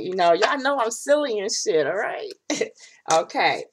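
ordinary talk

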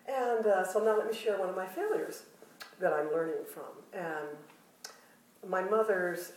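normal talking voice

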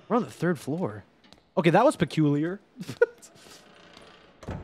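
normal talking voice

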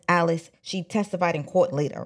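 ordinary talk, nothing marked

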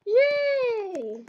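high, loud cheer; loud high happy yay; excited yay. Real, not acted. Dancing at party.

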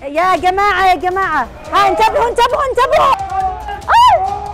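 panicked yelling. Acted. Staged brawl on stage, yelling.

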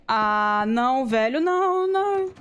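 loud, high, desperate no; panicked cry. Real, not acted. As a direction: cry out as it catches you. Being caught by chaser.

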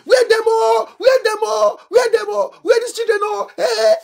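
put-on frantic parents calling children. Parents searching for their children at a protest, high frantic calls. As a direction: act out panicked parents calling out, pitched high. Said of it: "parents gonna call their children".

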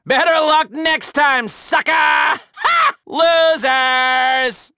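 shouted victory taunt; gloating shout. Acted. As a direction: crow over the losers. Taunting fleeing enemies.

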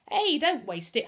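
high, loud objection; protesting. A narrator's reading. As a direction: protest indignantly over wasted food. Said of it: "Humphrey protested".

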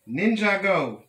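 high, loud ringing cry; battle yell. A narrator's reading. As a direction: yell it like a hero leaping into action. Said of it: "With a yell".

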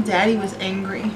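normal talking voice

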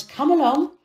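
quick, firm call to follow; wife briskly taking charge; brisk urging. A narrator's reading. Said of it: "His wife said at once".

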